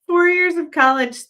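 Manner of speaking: high, loud sarcastic jab; mock outrage. Real, not acted. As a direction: pitch it up in disbelief. Mocking rant about lawyer.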